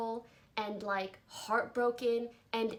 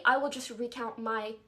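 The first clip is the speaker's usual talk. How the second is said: usual speaking voice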